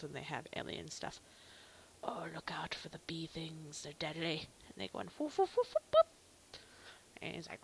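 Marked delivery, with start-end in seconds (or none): 2.0-4.5 whispered warning. Acted. Voicing NPC warning. 5.2-6.6 barking creature imitation.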